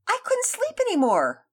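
high, loud excited cry; excited kids. A narrator's reading. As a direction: burst out with eager joy. Kids reply excitedly together.

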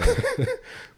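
high burst of laughter; quick high cackle; sudden laugh. Real, not acted. Laughing at the beer talk.

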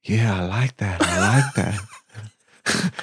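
deliberately bad New York accent, lazy and drawn out; a hammed-up, clumsy New York drawl, slowing right down on the repeat. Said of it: "I'm gonna do a really bad New York accent".

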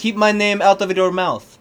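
put-on weak Russian accent as Putin; lightly voiced Putin, Russian accent; bad Russian accent. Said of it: "That was a bad Russian accent".

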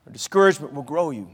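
low, soft reply; hushed counterpoint. Real, not acted. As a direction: sink the voice right down to answer the warning. Hushed answer to raised line.

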